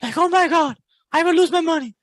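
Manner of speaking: high panicky mock voice with a gasp; scared bunny. Coach imitating a scared beginner trader, high mock panic. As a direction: mimic a frightened trader fretting over money. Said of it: "like, a scared bunny".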